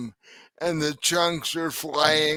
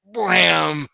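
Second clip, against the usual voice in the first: mock blam; 3 semitones higher, 6 dB louder than usual. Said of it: "you were like".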